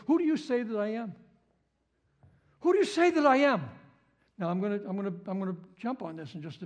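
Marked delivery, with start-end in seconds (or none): 2.6-3.7 emphatic challenge. Shown as a demonstration. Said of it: "Always saying".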